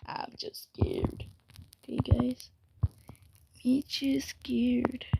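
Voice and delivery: slow frightened whisper; real scared whisper to viewers. Real, not acted. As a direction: hush it, still shaken. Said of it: "I'm scared".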